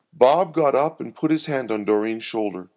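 usual speaking voice

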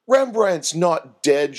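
slow, high, loud insistence; shouting. A narrator's reading. Doreen correcting the mistaken mourners, shouting. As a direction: shout it out word by word. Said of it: "shouted Doreen".

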